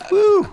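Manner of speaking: very high, loud whoop; relieved whoop. Retold or imitated. The host relieved after Evan's change of heart, high loud whoop. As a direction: let out a relieved falsetto whoop. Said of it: "I was like".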